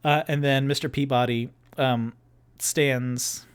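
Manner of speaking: usual speaking voice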